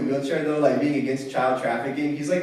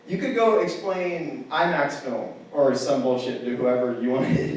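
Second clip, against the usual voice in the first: usual speaking voice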